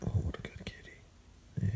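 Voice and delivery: slow whispered praise; sleepy talk to pet. Real, not acted. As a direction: whisper slowly so the cat can sleep.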